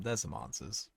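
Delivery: normal talking voice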